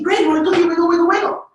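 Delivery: high, bright sing-song mom reply; cheerful mom command. Retold or imitated. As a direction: sing it up high to coax the kids. Mom answering kid's cookie request.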